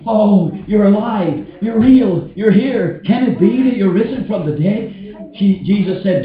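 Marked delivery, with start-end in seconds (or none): 0.1-4.9 high, amazed outburst; raised pitch, astonished relief; acted joyful disbelief. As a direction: exclaim as if overjoyed at a reunion. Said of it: "She wanted to say".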